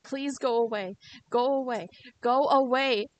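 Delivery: pleading that grows into a loud yell; building to a shout. Acted. Keiichi pushing Rena away.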